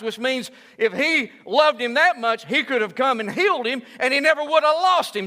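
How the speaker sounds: loud high-pitched sermon climax; full-voiced revival preaching; preaching at full force. Real, not acted. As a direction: preach it out, pushing every line.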